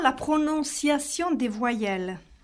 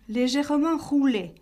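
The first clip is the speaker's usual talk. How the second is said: ordinary talk, nothing marked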